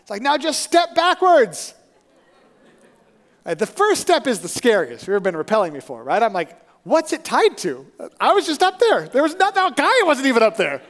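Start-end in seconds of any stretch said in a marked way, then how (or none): 0.2-1.7 high, slow, loud imitated call; called instruction. Stranger coaching climber to rappel, called out. 6.8-10.8 high, loud mock panic; hammed-up worried disbelief; alarmed protest. Climber doubting the stranger's rope, alarmed protest.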